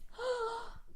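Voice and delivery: soft, high gasp; dramatic gasp. Real, not acted. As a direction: gasp softly at the sad ending. Reacting to Castiel leaving.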